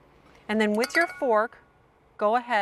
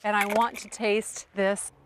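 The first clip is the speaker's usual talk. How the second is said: usual speaking voice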